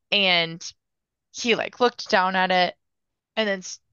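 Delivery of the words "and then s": normal talking voice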